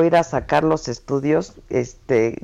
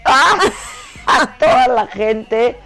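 laughing; 4 semitones higher, slower than usual. Real, not acted. Bursting out laughing.

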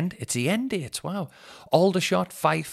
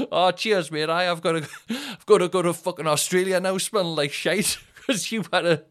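mock annoyed passenger; 7 semitones higher, 7 dB louder than usual. Mocking a passenger he delayed.

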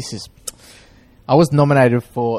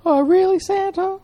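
much higher mock child voice; squeaky, hammed-up kid talking to Santa; high put-on voice. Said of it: "Why are you doing that voice?".

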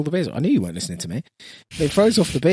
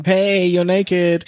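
high, very drawn-out mock greeting; teasing call-out; 6 semitones higher, 3 dB louder, much slower than usual. Podcaster calling to imagined naked listeners, teasing drawn-out voice. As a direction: draw it out high to tease someone.

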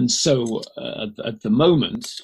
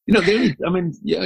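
laughing; 7 semitones higher, faster than usual. Real, not acted. Laughing after choking.

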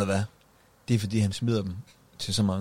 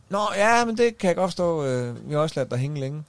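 high, chirpy fake-sorry voice; lightly hammed-up brush-off; mock breezy apology; 7 semitones higher than usual. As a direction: play the smug producer brushing it off. Imitating producers' fake apology.

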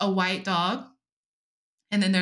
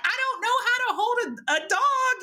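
helpless; 14 semitones higher than usual. Retold or imitated. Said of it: "I was like".

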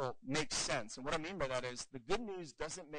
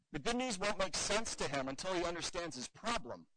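usual speaking voice

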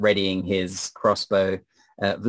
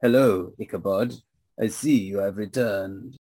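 vampire lord voice. Acted. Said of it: "And he says".

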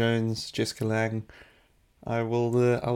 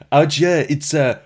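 loud, higher mock South African accent; Leonardo DiCaprio; 5 semitones higher, 7 dB louder than usual. DiCaprio chatting at the bar, imitated in South African accent. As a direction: attempt a South African accent while fighting laughter. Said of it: "and he says to her".

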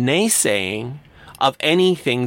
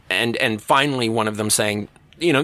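normal talking voice

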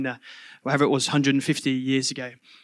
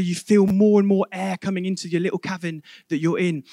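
usual speaking voice

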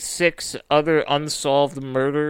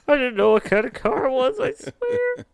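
high, fast nervous pleading; pleading squeak; 10 semitones higher than usual. Pretend. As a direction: beg innocence in a squeaky voice. Pleading reply to angry owner.

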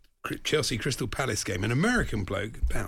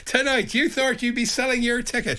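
higher, mock Irish presenter; Eamon Andrews, Irish accent; 11 semitones higher, 4 dB louder than usual. Co-host mocking the slipped accent, mock Irish presenter. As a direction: put on a teasing Irish accent. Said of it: "That was Eamon Andrews".

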